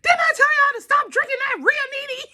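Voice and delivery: very high mock scolding; imitating a dad's outraged reproach; exasperated father. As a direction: squeal the told-you-so.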